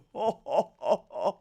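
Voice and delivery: haughty, drawn-out fake chortle; mock posh laugh. Vlogger as millionaire sneering at little people, mock laugh. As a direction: laugh like a caricature toff.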